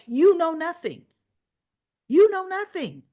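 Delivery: high, loud, scornful shout; loud scornful shout at Cody; shouted retort. Retold or imitated. Retold soap quarrel, Sasha.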